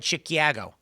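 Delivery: "Chicago" with a "kya" middle, vowel as in cat; mock "Chicago" as shi-kyah-go with a flattened A; fake Chicago vowel. Local debunking the bit, "Chicago" with an exaggerated cat vowel. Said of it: "No one ever said".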